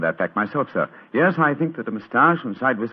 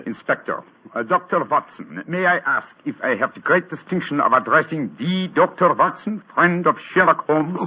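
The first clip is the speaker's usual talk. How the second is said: put-on a disguised detective's lightly held German accent; German count; 4 semitones higher than usual. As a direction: greet the visitors as a stiff German count. Holmes disguised as the Graf.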